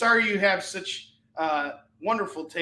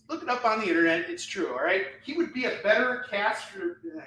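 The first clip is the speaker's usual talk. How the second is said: usual speaking voice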